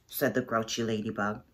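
ordinary delivery, nothing marked

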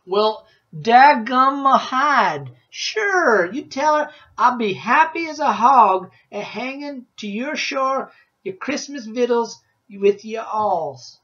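put-on loud, high, backwoods old woodsman drawl; old woodsman Rancid. A narrator's reading. Woodsman tells the boy about Christmas trees, loud backwoods voice. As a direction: boom it out like a grizzled mountain man.

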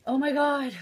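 loud, high burst of exasperation; exasperated outburst. Real, not acted. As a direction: burst out, fed up with a blocked nose. Exasperated by her blocked nose.

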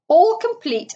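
loud, high, triumphant announcement; pleased-as-punch flourish. A narrator's reading. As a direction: crow it proudly. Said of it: "said the Toad triumphantly".

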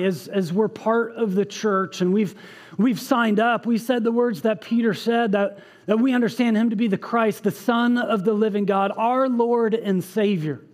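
normal talking voice

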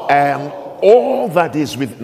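"all" leaned on in "And all that is within"; stress on ALL. Shown as a demonstration. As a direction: push the word "all". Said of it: "stress the word I'm looking for".

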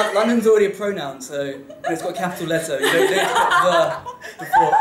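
high, loud pedantic correction with a laugh; mock correction. English guy correcting American girls, teasing. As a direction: lecture them on grammar, half joking. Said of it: "I'm like".

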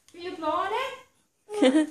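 high, slow coaxing, laughing; saccharine. Real, not acted. Mom coaxing baby.

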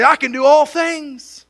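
loud, high, slow, proud declaration of "I can do all things"; mocking the shortened verse. Preacher mocking how believers quote it, loud proud slogan. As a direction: boom it out like a cocky slogan. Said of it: "And we shorten it here, say".

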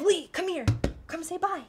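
high-pitched calling voice; high, beckoning summons; coaxing call. Real, not acted. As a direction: call them over in a high, sing-out voice. Calls Lee over to say goodbye.

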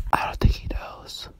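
unvoiced whisper aside. Whispering an aside about the doggy. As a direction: breathe it so he can't hear. Aside so the other won't hear.

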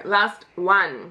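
usual speaking voice

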